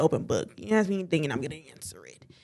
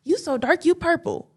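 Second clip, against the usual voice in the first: teasing cousin; 9 semitones higher than usual. Retold or imitated. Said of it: "My own cousin used to be like".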